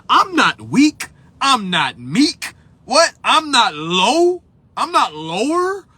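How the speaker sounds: slow, rising, offended denials; retold affront, drawn-out and questioning; indignant disbelief. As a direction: stretch each denial up into an incredulous question. Retells indignant reaction to definition.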